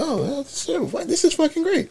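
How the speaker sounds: mock flustered clerk. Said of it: "the guy tried to say".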